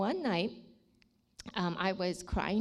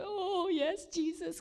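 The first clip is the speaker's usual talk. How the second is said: tearful yes; 10 semitones higher, slower than usual. Retold or imitated.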